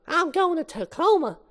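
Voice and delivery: high-pitched small bug; little ladybug voice. Acted. Ladybug tells the others its destination, high little voice.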